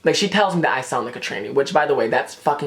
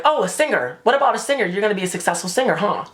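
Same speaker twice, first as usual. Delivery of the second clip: higher, mock-eager psychic; eager guessing; 6 semitones higher than usual. Psychic pitching a singing career to Natalia, mock eager.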